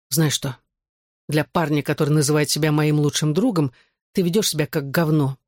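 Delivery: usual speaking voice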